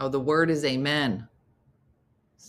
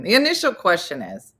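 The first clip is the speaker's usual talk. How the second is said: ordinary talk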